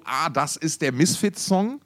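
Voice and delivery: ordinary talk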